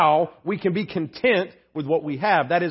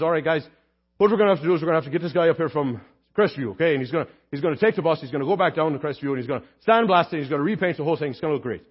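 put-on fast, lower Irish accent; Luke Philpott; 3 semitones lower, much faster than usual. Deacon pitching the bus job to the meeting, fast Irish accent. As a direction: rattle it off in a thick Irish brogue. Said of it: "I try to use his voice".

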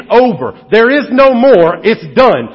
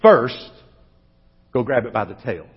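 God speaking softly; 10 semitones lower, 6 dB quieter than usual. Retold or imitated. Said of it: "God says".

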